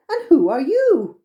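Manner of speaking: curious question. A narrator's reading. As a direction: ask the stranger wide-eyed. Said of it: "they suddenly said".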